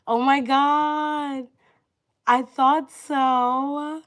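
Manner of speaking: loud, high, drawn-out excited squeal of words; thrilled. Real, not acted. Streamer learning her viewer is a Scouser, thrilled. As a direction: gush slowly and loudly, pitch way up.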